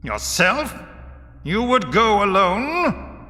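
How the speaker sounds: high, drawn-out disbelieving question; incredulous and slow; astonished. A narrator's reading. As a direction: ask in loud astonishment, pitch soaring. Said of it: "said Voris in astonishment".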